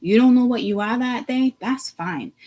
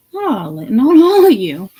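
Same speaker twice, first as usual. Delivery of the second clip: slow, loud and emphatic; louder; 3 semitones higher, 10 dB louder than usual. Real, not acted. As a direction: raise the voice, slowing down. Ranting, suddenly loud.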